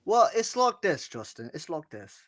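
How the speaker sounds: mock British accent. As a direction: lay the British accent on for your cohost. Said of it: "You can't do any accent with me".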